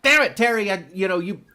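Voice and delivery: loud, high frustrated outburst; mock exasperated dad. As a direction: snap at Terry in frustration. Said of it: "he's like".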